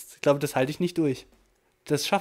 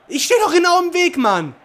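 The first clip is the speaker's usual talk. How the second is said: loud, much higher complaint at the game; frustrated outburst; 12 semitones higher, 8 dB louder than usual. Real, not acted. Gamer raging at his defender standing uselessly in the way, loudly. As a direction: vent at the defender's stupidity.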